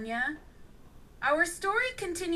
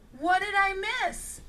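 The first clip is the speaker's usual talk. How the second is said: usual speaking voice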